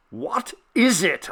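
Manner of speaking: a startled man crying out in the dark. Acted. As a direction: shout back in alarm. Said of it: "I cried, hardly less loud".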